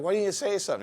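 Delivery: acted high, loud complaint; exasperated protest. Tattooer to friend after using the wrong color, exasperated protest. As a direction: pitch jumps right up in mock dismay.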